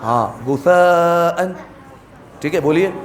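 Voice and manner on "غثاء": غثاء with a thick غ and a thin ثا, slowly; heavy ghain, thin sa. Shown as a demonstration. Teacher contrasting a thick غ with a thin سا. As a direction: fatten the غ, keep the ثاء thin in غثاء. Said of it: "پہلے غین کو موٹا کریں گے اور سا کو پتلا".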